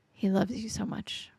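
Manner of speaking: soft unvoiced whisper, intimate. Real, not acted. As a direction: breathe it to the listener like a secret. Repeated line, voiced then whispered.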